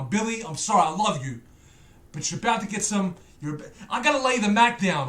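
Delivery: ordinary delivery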